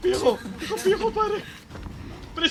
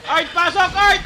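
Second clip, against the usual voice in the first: shouting; 6 semitones higher, 8 dB louder, slower than usual. Real, not acted. Yelling at teammate in game.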